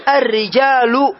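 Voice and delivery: "الرجال" drawn out very slowly, the final u of رجالُ clearly sounded; case ending demo. Shown as a demonstration. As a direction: stretch "الرجال" and let the ending ring. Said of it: "أقول". (نطق "الرجال" ببطء مع إظهار الضمة)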